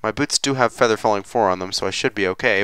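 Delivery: normal talking voice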